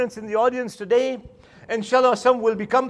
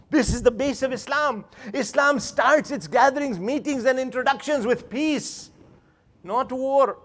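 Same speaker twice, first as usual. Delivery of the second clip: ordinary talk, nothing marked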